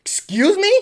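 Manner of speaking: high, incredulous question; taken aback. Retold or imitated. Worker questioning the boss's order, taken aback. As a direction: react in mock disbelief. His shocked reply to boss.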